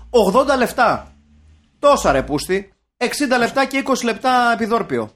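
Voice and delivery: loud, high indignant rant; mock exasperation. Ranting about film length like a menu, mock exasperated. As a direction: reel off the list, punching it out loudly.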